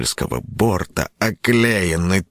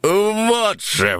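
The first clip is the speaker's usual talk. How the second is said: grand, high host announcement; pompous proclamation. Acted. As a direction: proclaim it with pomp. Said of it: "возвестил ведущий пафосно".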